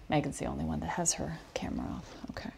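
whispered tease; whispered aside. Real, not acted. Teacher teasing a student with her camera off, whispered. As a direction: lean in and whisper it to the group.

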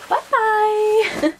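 high, loud singsong bye-bye; sing-song goodbye. Acted. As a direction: wave it off brightly. Tossing clothes away, cheerful goodbye.

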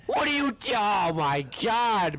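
ordinary delivery

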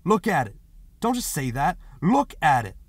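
loud, urgent command; crying out. A narrator's reading. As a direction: cry out for them to look. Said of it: "He wheeled around and cried".

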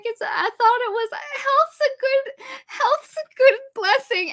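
high, slow mock-weepy wail; mock crying. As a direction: imitate a teary old fairy. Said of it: "and she cries 'cause she's like".

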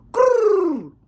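high, loud rolled trill; long, high vocal ringtone; imitated phone ring. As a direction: trill like an incoming call. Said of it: "I get a bird call now".

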